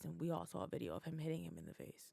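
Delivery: whispered aside; whispering. Real, not acted. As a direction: drop to a whisper. Muttered aside about the slap.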